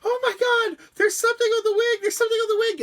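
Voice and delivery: hammed-up Muppet panic, very high and loud; panicked Miss Piggy. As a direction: shriek it like a terrified puppet diva. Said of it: "and she's going".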